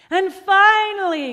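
high, loud showman's announcement; ringing, excited call; triumphant cry. A narrator's reading. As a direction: proclaim the grand finale. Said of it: "cried Giovanni".

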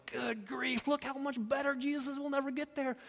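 mock-exasperated good grief; mock despair. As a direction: voice the hopeless believer. Imagined despairing believer.

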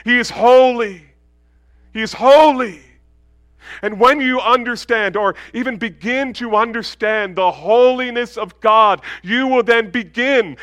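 loud, high, fervent sermon; full-force preaching. Real, not acted. Preacher urging the church to fear God's holiness, loud. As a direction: drive the warning home to the pews.

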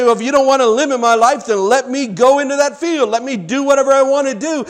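high, loud arguing back; acted-out rebellious insistence; defiant demand. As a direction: demand your own way from God. Sinner argues back at God.

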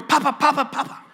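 put-on high, loud child calling papa; toddler calling papa. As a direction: cry out papa like a two-year-old. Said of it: "two-year-old going".

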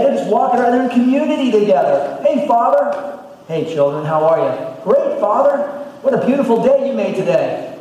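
high, loud cheerful greetings; bright happy children greeting Father; joyful garden scene. Acted. Adam and Eve cheerfully greeting God in the garden. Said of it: "Adam and Eve with God".

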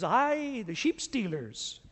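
put-on a light Scottish accent, slowed down on "the sheep stealers"; slow Scottish burr. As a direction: draw out "the sheep stealers" like a Scots shopkeeper teasing. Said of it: "He goes".

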